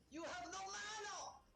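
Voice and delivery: high, slow robotic game voice; game announcer voice. Pretend. Player mocking the game's no-mana warning, slow high voice. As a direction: mimic the game telling you off.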